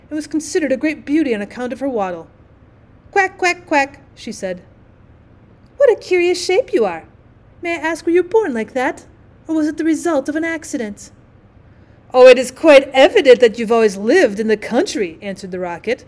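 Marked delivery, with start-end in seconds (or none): 3.1-4.0 high, brisk duck quacks; duck quacking. A narrator's reading. Duck greeting the rocket, high quacks. Narrator does the duck's quacking. 5.8-11.1 higher-pitched, inquisitive duck; curious duck. A narrator's reading. Duck questioning the rocket's shape, high and curious. 12.1-15.2 loud, emphatic condescension; pompous rocket. A narrator's reading. Rocket answering the duck, loud and condescending.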